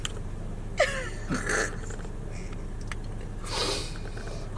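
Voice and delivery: a soft laugh through tears; teary laugh. Real, not acted. Vlogger laughing at herself for crying, soft teary laugh.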